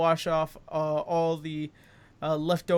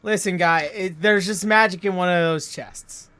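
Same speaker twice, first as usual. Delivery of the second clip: loud irritated snap; annoyed; 8 dB louder than usual. Acted. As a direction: snap back at him. Elf snapping at snooty player.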